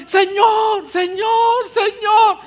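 high loud pleading Señor, Señor, Señor; hammed-up whining plea; begging husband. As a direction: wail it like a needy husband. Husband voiced crying out.

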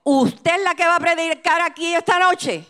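high, imposing challenge from a big pastor; stern pastor. Retold or imitated. Big pastor confronts the visiting preacher, stern demand.

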